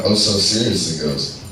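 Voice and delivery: ordinary delivery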